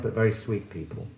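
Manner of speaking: ordinary talk, nothing marked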